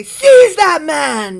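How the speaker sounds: high, loud, drawn-out playful shout; shouted command. Pretend. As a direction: yell it like a mock royal order. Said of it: "I've always wanted to shout that".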